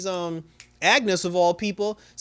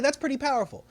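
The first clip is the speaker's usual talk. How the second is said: impressed reaction; 10 semitones higher, faster than usual. Retold or imitated. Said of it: "And I was like".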